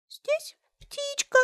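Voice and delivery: very high, slow tiny voice; thin little voice. Acted. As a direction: squeak it like a little bird. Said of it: "тоненьким голоском отвечает мальчик".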